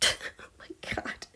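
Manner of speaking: hushed aside; whisper. Real, not acted. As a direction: breathe it out softly. Laughing whisper to herself.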